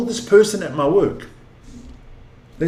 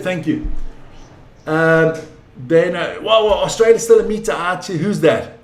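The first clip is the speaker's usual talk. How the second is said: normal talking voice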